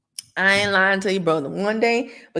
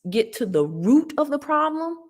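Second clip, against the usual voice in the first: usual speaking voice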